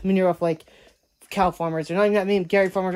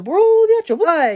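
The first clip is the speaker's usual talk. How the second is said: high, loud roll the outro; upbeat outro call; 12 semitones higher, 8 dB louder, slower than usual. Real, not acted. Vlogger calling for the outro, high and loud. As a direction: announce the outro with a burst. Signing off the vlog.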